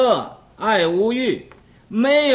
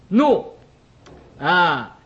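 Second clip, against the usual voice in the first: ordinary delivery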